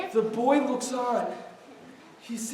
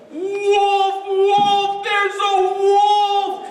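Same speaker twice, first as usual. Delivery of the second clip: higher, louder drawn-out cry; screaming alarm; 7 semitones higher, 7 dB louder, much slower than usual. Acted. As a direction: call out to the whole valley. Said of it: "he screams again at the top of his lungs".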